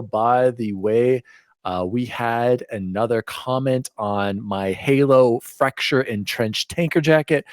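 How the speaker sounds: usual speaking voice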